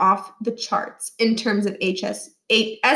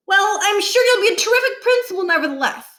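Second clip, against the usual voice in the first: put-on higher, nervous boyish recovery; nervous kid; 10 semitones higher, 4 dB louder than usual. A narrator's reading. Boy nervously flattering the stern principal. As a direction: cover the awkward moment brightly. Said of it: "Niles shrugged nervously".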